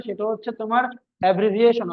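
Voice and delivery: ordinary delivery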